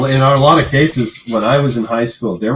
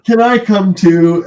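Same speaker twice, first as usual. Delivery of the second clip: put-on old woman voice; 9 semitones higher, 7 dB louder, slower than usual. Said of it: "then she's like".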